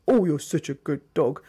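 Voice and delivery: "good dog" said low and harsh. Shown as a demonstration. Trainer showing mismatched tone, harsh "good dog". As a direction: growl the praise at the dog. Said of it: "with a harsh voice".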